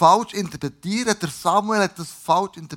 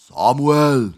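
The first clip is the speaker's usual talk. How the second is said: lower, loud, sustained call; 3 semitones lower, 8 dB louder than usual. A narrator's reading. Jahwe calls Samuel once more, calling out. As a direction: stretch the name out as a summons. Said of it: "Jahwe rief noch einmal".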